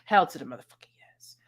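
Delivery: hushed whispered aside; conspiratorial whisper. Pretend. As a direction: whisper it like a secret confession. Answering own question sarcastically.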